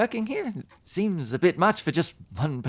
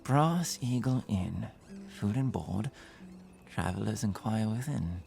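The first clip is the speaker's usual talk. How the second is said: reading a sign; 10 dB quieter than usual. Acted. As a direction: murmur the signboard words under your breath, unhurried. Traveller reads the inn sign.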